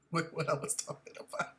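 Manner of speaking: usual speaking voice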